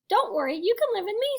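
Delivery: high, quick, upbeat offer; reassuring sister fish. A narrator's reading. Sister fish reassures her brothers.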